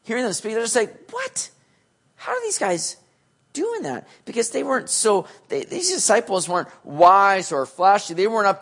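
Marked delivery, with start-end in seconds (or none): 1.1-4.1 amazed. Retold or imitated. Amazed crowd in Acts.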